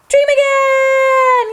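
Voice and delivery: high, slow, shouted show title; excited show shout. Acted. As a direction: announce it big and drawn out for the kids. Host announces show title excitedly.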